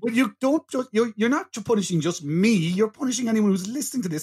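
high-pitched whiny complaint; mock-indignant protest. Podcaster objecting that listeners are punished too, mock-aggrieved.

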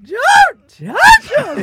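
exaggerated sky-high screech, drawn out slowly; mock your own squeaky yell; hammed-up falsetto scream, very loud; voice shoots up. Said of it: "always is like".

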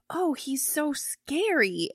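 slow, high-pitched retold exclamation; retold reaction. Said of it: "and I was like".

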